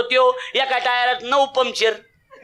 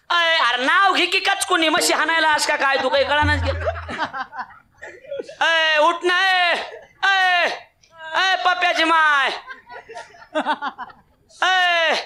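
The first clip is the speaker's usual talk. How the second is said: calling out; 7 semitones higher than usual. Retold or imitated. Villager shouting for wife.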